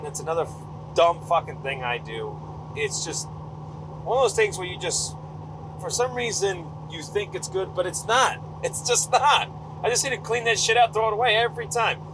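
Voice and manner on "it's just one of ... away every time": high-pitched rant at yourself; worked-up, higher-voiced self-reproach; exasperated. Real, not acted. Ranting at own dumb habit.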